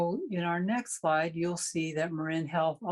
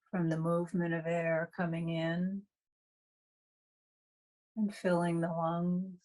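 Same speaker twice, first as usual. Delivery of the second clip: guided meditation. Real, not acted. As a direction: guide them through breath awareness, leaving long silences between phrases.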